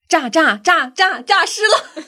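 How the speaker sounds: put-on high stammered Yunnan-accent cry; Lord Bao. Quiz host mimics a character crying out at a corpse, Yunnan accent. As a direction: stutter it high in a put-on Yunnan accent, then stretch the end. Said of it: "这个口音很难拿捏啊".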